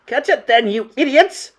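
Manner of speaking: high, loud scolding shriek; furious witch scream. A narrator's reading. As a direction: scream orders at useless underlings. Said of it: "screamed the Grand High Witch".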